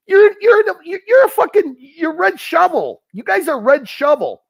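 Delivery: high, tongue-tied fury; sputtering anger. Real, not acted. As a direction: sputter in rage. Sputtering rage.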